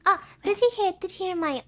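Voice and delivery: usual speaking voice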